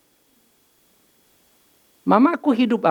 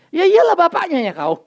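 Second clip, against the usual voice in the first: high, louder cheeky comeback; child's retort; 10 semitones higher, 4 dB louder than usual. Retold or imitated. Child answering boasting father, mock cheeky. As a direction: snap back like a cheeky kid.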